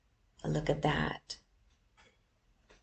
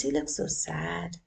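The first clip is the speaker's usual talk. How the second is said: usual speaking voice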